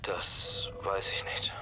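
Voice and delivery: slow, barely voiced whisper. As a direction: whisper it, evasive and small. Verhörter Mann flüstert ausweichend. (langsam geflüstert)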